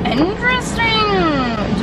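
slow, high intrigued drawl; drawn out. Real, not acted. Reacting to a barista's new drink, slowly intrigued. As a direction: savour the word, intrigued.

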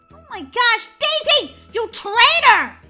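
high, loud accusation; outraged yell. Acted. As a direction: yell at a traitor sister, drawing out the insult. Accuses sister of betraying her.

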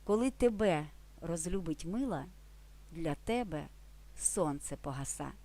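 gentle poetic reading; poem recital. Shown as a demonstration. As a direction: softly recite the poem line, fading at the end. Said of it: "Прекрасно демонструє ці правила поетичний рядок".

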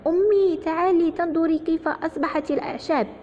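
put-on high-pitched boy calling his mother, loud at first; calling out loudly. A narrator's reading. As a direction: shout for Mum to come and see. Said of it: "نادى على أمه بصوت عال".

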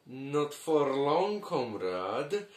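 low, slow, soft threatening voice; sinister low warning, slowed right down; menacing villain. Acted. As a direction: lean in and threaten quietly. Invader menaces the Roman Empire.

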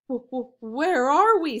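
put-on scared stammering boy. A narrator's reading. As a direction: stumble over the first word. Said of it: "stammered Jack".

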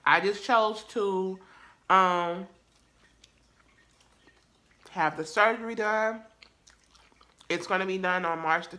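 eating between words; talking while chewing. Real, not acted. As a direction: mouth partly full. Seen 1: Bites food just before; jaw and lips chew through early words.

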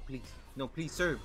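ordinary talk

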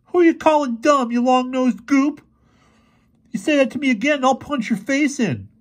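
put-on very high, loud boy's retort; angry threat. A narrator's reading. Insulted boy threatens to punch Fergie, angry. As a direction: lash out as an insulted teenage boy.

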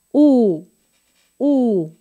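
"U" with lips pushed out and rounded; exaggerated rounded Spanish "U". Shown as a demonstration. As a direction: pout the lips forward for "U". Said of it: "muy hacia afuera".

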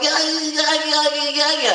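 mock baby talk to girlfriend. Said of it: "I baby talked with her".

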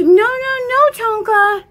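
put-on high, loud baby voice telling the dog no; voicing the toddler. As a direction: speak for the little girl, bossy and squeaky.

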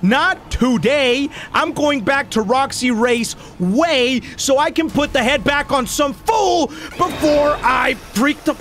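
high, stretched-out frantic declaration; drawn-out high-pitched fretting; mounting panic. Real, not acted. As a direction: drag the words out high, close to losing it. Defiant panic before freaking out.

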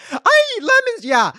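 high, loud sarcastic mimic; mocking chat. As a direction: squeal it like a taunting copycat. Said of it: "timing you out".

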